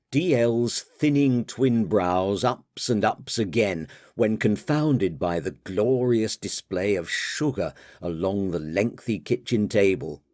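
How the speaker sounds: ordinary delivery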